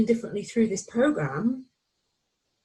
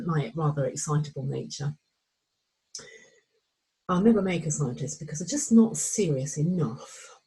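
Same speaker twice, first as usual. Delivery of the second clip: ordinary talk, nothing marked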